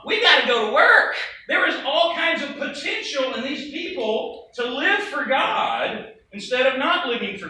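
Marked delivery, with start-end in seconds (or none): 0.0-1.2 loud, urgent call to action; rally the workers, voice pushed louder; acted rallying cry. Preacher voicing the eager response to a harvest, loud rallying.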